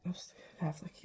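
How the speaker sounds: hushed whisper. Acted. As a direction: breathe it like a secret. Whispered repeat.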